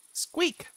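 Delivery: excited squeak. Acted.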